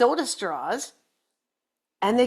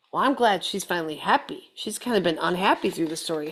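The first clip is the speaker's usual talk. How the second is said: usual speaking voice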